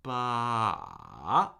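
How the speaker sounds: "Ba." stretched long, dipping low then rising; drawn-out falling-rising "Ba."; slow third tone. Shown as a demonstration. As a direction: slowly draw "Ba." down to the bottom and up. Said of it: "You can do it slowly".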